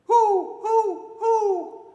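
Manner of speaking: high, loud hooting owl. Acted. Storyteller voicing the owl for the children, high loud hoots. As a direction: hoot like a solemn old owl. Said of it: "The solemn old owl said".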